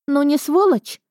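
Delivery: high, outraged rhetorical question; aggrieved and high; indignant. A narrator's reading. Friend's indignant complaint, then echoed.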